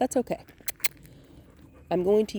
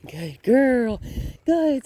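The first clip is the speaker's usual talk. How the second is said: excited praise; 7 semitones higher, 6 dB louder, much slower than usual. Real, not acted. Praising the dog on a walk.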